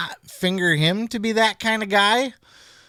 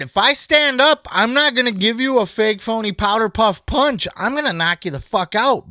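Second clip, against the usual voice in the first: louder, higher mock threat; quoting angry threat; 6 semitones higher, 3 dB louder than usual. Retelling Waller's threat to the nerd, loud and higher. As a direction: bark it like the angry wrestler. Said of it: "As Waller said".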